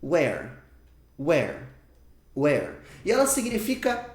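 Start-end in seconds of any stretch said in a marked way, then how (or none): 0.0-2.7 slow, careful English "Where", lower pitch; teacher modeling "Where" in English; model pronunciation. Shown as a demonstration. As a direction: say "Where" clearly as a model for learners. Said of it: "pronúncia dela é algo tipo assim".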